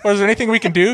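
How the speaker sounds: high, loud comic pleading; mock worried questioner. Friend retelling comedian's bit, asking doomer for hope, high and loud. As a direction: imitate a questioner, pitched far up and loud. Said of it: "And he's like".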